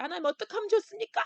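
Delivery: fast, high plea rising on 좋습니까; high pleading cry. Pretend. Pastor pleads with God over the crisis, high rising cry. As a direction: ask 하나님 quickly in a high voice, rising on 좋습니까. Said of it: "그렇게 부르짖는다는 거예요".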